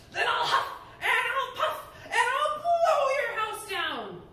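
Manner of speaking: high, loud laughing wolf threat; big bad wolf. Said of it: "playing the role of the Big Bad Wolf".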